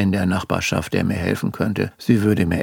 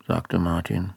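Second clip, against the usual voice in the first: plain reading voice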